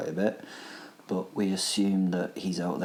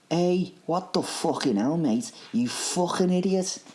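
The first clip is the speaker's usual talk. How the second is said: high, louder-edged Liverpudlian outburst; soft Liverpudlian accent; 11 semitones higher, 5 dB louder than usual. Acted. Angry passenger to the man he ran over, Liverpudlian accent. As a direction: swear at him in a Liverpool accent, pitched up. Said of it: "said in a soft Liverpudlian accent".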